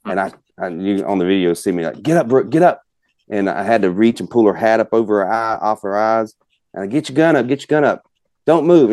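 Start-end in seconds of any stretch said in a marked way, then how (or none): 2.0-2.8 urgent, higher-pitched retold yell; frantic yell. Father rousing his kid as a gobbler appears, frantic yell. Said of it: "I'm yelling, and I'm frantic". 6.9-8.9 higher-pitched, urgent retold orders; frantic yell. Father urging his kid to get the gun up, frantic yell. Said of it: "I'm yelling, and I'm frantic".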